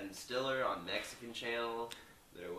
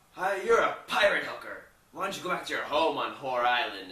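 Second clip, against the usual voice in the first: louder, mocking insult; taunting yell; 4 semitones higher, 15 dB louder than usual. Ron taunting Veronica in the office, louder. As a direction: hurl the insult across the room. Newsroom insult during their fight.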